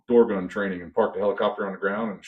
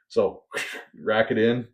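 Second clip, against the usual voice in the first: ordinary delivery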